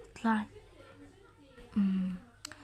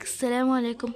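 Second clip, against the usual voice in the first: ordinary talk, nothing marked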